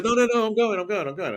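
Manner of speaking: high, rapid-fire mock insistence; eager insistence. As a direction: act out a friend who won't be left behind. Friend insisting he is coming along.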